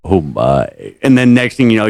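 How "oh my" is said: low, drawn-out "Oh my"; deep mock-scandalised "Oh my"; George Takei catchphrase. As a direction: drop the pitch and savour it. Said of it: "and he goes".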